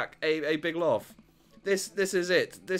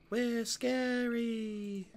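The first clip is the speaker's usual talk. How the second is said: much higher, very slow eerie; proudly spooky children; slow high creepy twin voice; 7 semitones higher, 4 dB quieter, much slower than usual. Acted. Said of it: "creepy twin shtick".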